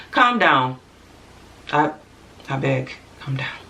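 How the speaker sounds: badly faked African accent, trailing low; light, clumsy African accent. Begging a show-off man to calm down, mock African accent. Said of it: "That was a horrible accent".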